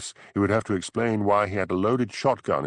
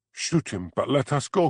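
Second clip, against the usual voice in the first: pleading cry; 6 semitones higher than usual. A narrator's reading. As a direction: beg for your life. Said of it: "cried the woman".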